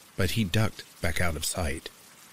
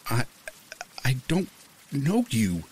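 high, stammering, scared; frightened stammer; 7 semitones higher than usual. A narrator's reading. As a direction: stumble out the words in fear. Narrator gripped, panicking.

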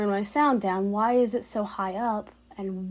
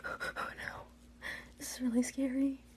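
frightened whisper; 14 dB quieter than usual. Real, not acted. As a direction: whisper it, hiding. Hiding from clone.

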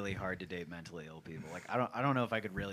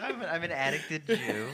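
laughing; 7 semitones higher, 7 dB louder than usual. Real, not acted. Joking while still laughing.

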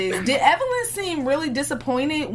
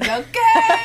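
excited; 11 semitones higher, 5 dB louder than usual. Real, not acted. Hyping up the show's name.

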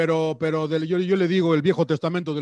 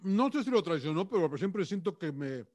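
mouth full; 11 dB quieter, slower than usual. Real, not acted. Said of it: "perdón que estoy comiendo".

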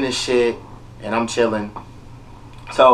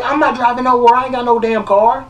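high, exasperated demand; pitched-up indignant question; indignant challenge; 8 semitones higher than usual. Retold or imitated. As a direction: prove your point, fed up. Arguing with nurse.

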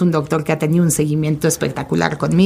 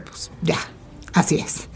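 whispered; 9 dB quieter than usual. Retold or imitated. Resigned aside in retold quote.

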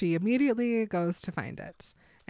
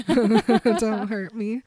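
drawn-out, louder mock plea; 3 semitones higher, 7 dB louder, much slower than usual. Edward, hands up to Bella, mock pleading. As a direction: plead loudly and slowly, hamming it up. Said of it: "hands in the air, like".

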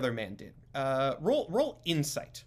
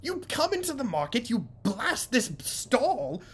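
high, strained voice climbing to a near-squeal; exasperated shopkeeper; 9 semitones higher than usual. Acted. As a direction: berate the frog, rising in pitch. Shopkeeper angrily interrogates.

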